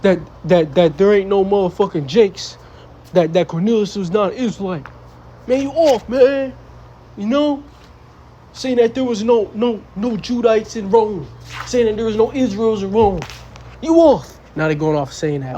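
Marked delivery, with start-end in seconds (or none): 5.5-14.4 high, heated "you off"; heated rebuke. Real, not acted. Preacher calls out brothers who fell off, heated high rebuke. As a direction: call them out with rising heat.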